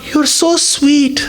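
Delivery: high, drawn-out, crying devotee voice; adoring devotee. Acted. Devotee gushing to the Lord, high and slow. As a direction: melt before the Lord, stretching every word. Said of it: "every devotee who goes in the presence of the Lord says".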